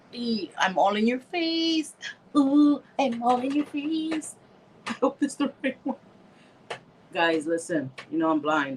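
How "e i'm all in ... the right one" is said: playful high. Real, not acted. Too close to the camera.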